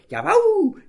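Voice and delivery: very high, loud yapping yes; the little dog joining the cheer with a high yap; dog's yes. Acted. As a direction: bark it like a happy puppy. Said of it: "bekräftigte auch Schnorki".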